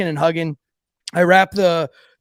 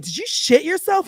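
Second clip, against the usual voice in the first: high, rising mock disbelief; incredulous question; 11 semitones higher than usual. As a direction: imitate friends appalled and asking. Group questions him in disbelief.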